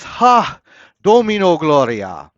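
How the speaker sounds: ringing, high, loud battle cry; a herald's triumphant shout. Acted. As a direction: call it out like a trumpet blast. Trumpet cry in the poem.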